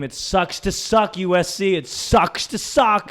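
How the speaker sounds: loud, high taunting chant; retold scream. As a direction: shout it out gloatingly at the rival fans. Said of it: "I scream".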